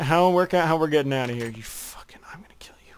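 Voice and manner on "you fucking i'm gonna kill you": menacing whisper; whispered threat. Pretend. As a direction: hiss the threat quietly. Mock threat to teammate.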